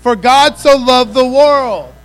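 loud, slow congregational chant; booming slow unison call; call and response. Shown as a demonstration. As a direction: lead the room to say it together. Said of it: "Say it with me".

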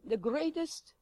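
usual speaking voice

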